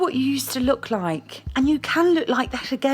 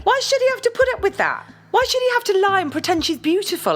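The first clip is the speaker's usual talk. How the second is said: heated indignation; 7 semitones higher than usual. Acted. Heated argument with her boyfriend.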